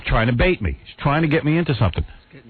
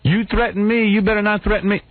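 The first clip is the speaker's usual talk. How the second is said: higher, whiny accusing tone; mocking photographer; 9 semitones higher than usual. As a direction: parody his indignation. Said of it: "I didn't start in with him".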